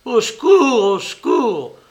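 cri aigu et très fort qui retombe; appel au secours. Acted. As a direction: hurle à l'aide, paniqué. Appelle à l'aide, saisi au collet. (appelle au secours, terrifié, tenu par son agresseur)

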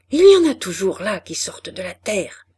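ordinary delivery, nothing marked

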